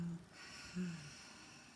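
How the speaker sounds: a long, airy breath out through the mouth; exhale sigh. Shown as a demonstration. Yoga teacher showing the kids how to exhale, with a long audible sigh. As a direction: let the breath go audibly so the class can copy it. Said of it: "and exhale".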